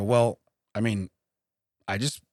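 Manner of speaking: hemming and hawing softly; hesitant demurral. Retold or imitated. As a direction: trail through a reluctant, quiet no-thanks. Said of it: "I said it like that".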